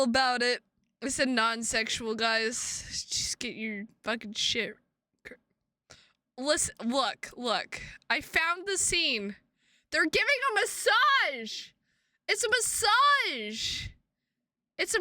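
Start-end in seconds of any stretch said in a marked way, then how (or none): none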